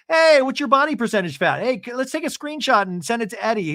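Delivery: high, loud sarcastic gushing; mock enthusiasm. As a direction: mockingly play an over-keen flatterer.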